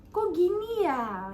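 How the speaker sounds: slow, high puzzled wondering; drawn-out, high "really, like this?". Retold or imitated. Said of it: "aku merasa kayak". (heran dan bingung)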